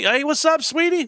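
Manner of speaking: very high imitation of a sweet hello; hammed-up high sweet talk; mock-sweet greeting. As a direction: pitch it way up, teasing the endearment. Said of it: "they talk a little sweet".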